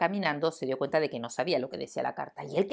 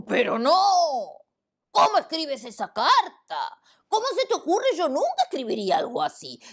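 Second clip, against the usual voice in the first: louder, higher outraged protest; indignant lion; 8 semitones higher, 4 dB louder than usual. Acted. Lion to the monkey, higher louder indignation. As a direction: scold like an offended lion.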